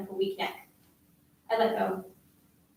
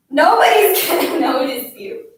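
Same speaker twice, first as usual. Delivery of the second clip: loud, higher-pitched put-down; scornful outburst; 6 semitones higher, 11 dB louder than usual. A narrator's reading. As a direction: throw the insult out at full voice. Bully's scolding tirade continues.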